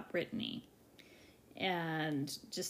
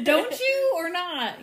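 scolding a bad dog; 10 semitones higher, 9 dB louder, slower than usual. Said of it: "treat her like she's a, an, a dog that's misbehaving".

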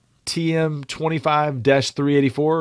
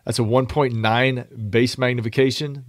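ordinary delivery

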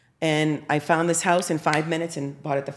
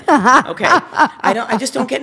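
laughing; 8 semitones higher, 10 dB louder than usual. Real, not acted. Embarrassment question, laughing.

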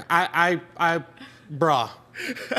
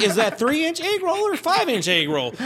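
higher, mock-curious voice; mock viewer; 10 semitones higher than usual. Comedian mocking a porn viewer, higher mock-curious voice. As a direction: ham it up as a baffled viewer.

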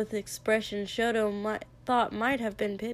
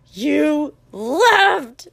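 loud, raised-pitch, drawn-out cry; accusing yell; 5 semitones higher, 9 dB louder than usual. Acted. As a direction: stretch both words into a loud accusation. Repeated accusation in the fight.

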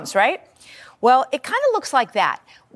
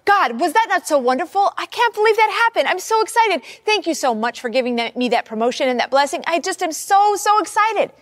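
bubbling over, higher and brighter; a giddy prayer of thanks; excited prayer; 7 semitones higher than usual. Retold or imitated. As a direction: gush to God like a thrilled friend. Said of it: "I might say".

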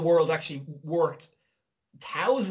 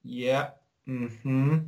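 unbothered waiter; 3 semitones lower than usual. Retold or imitated. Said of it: "they're like".

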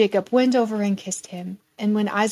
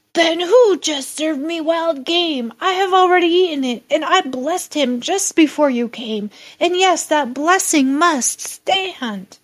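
a touch of trembling alarm, higher; 7 semitones higher, 4 dB louder than usual. Acted. Isaac realising he was tricked by Jacob, mild trembling alarm. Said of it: "Isaac began to tremble uncontrollably".

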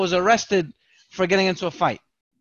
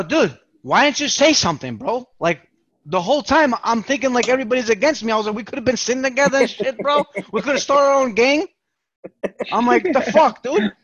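higher, louder, rushing with happy indignation; excited disbelief; 7 semitones higher, 5 dB louder than usual. Retold or imitated. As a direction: burst out like finding a friend in a crowd. Excited reunion with cellmate.

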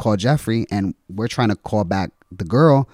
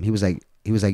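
ordinary talk, nothing marked

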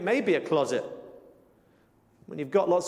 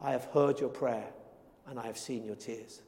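much softer and lower, almost breathed; hushed tender promise; 8 semitones lower, 9 dB quieter than usual. Acted. Preacher closing on God's promise to the grieving, hushed and tender. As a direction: fade to a near-hush as you reassure. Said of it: "But he always says".

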